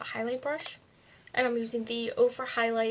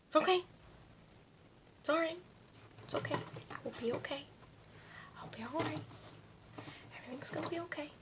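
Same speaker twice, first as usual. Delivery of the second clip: self-reassuring; 4 semitones higher, 9 dB quieter, faster than usual. Real, not acted. Reassures herself after frustration.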